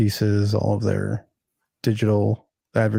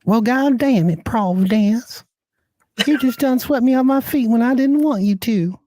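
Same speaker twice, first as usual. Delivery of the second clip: much higher, louder exaggerated Southern belle accent; 13 semitones higher, 4 dB louder than usual. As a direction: ham up an angry Midwest girl going Southern. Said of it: "they slip into that Southern accent".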